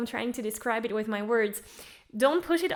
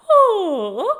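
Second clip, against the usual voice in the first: high, loud yawning ooh; 8 semitones higher, 13 dB louder than usual. Shown as a demonstration. Voice coach introduces next exercise, yawning sound. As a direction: let out a big yawny ooh. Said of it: "next exercise is a yawn".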